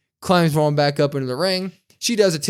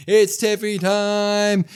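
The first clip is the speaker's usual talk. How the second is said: slow, higher mock catchphrase; imitating Tiffany; 8 semitones higher, 4 dB louder, much slower than usual. Host retelling Tiffany's promo, slow higher mock delivery. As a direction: drag out the catchphrase like a proud heel. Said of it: "and she goes".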